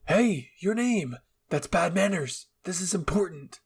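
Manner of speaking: high, loud, slow panicked call; scolding a sleeping friend in a panic. Acted. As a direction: urgently try to wake her. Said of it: "Eren immediately panicked".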